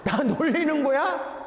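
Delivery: high, slow, incredulous retort; hint of a scornful beggar; annoyed cripple. Crippled man retorts mockingly. (비꼬는 듯한 항의)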